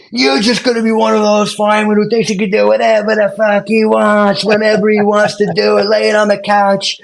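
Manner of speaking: high, loud sneering rant; mock yelling instructor. Campese chewing out the recruit at training, high mock yell. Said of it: "rips me like you can't believe".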